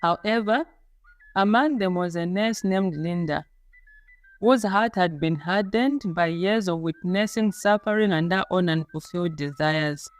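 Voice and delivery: ordinary delivery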